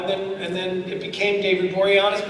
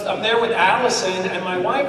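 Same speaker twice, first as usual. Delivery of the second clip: usual speaking voice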